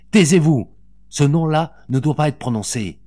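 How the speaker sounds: loud, high, forceful command; urgent order. A narrator's reading. Mother stopping a revelation before her son, urgent order. Mother silences the revealing woman.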